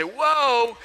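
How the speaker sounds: overwhelmed whoa. Retold or imitated. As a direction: play the swamped student reacting. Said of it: "you're gonna probably say".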